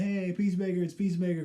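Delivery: high, eager shout of recognition; excited fans calling out. Retold or imitated. Fans spotting Peacemaker on the street, thrilled and high-pitched. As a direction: cheer his name like thrilled fans. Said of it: "going crazy".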